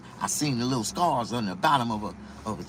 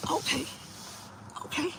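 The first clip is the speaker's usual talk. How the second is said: impressed okay; 9 dB quieter than usual. Retold or imitated. High impressed okays while she dances.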